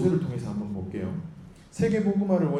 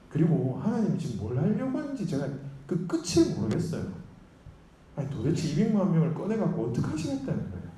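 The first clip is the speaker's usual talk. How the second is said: higher-pitched acted protest; exasperated complaint; 6 semitones higher than usual. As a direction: argue back in bewildered exasperation. Moses complaining to God, acted. (답답해서 따지듯 높은 목소리로)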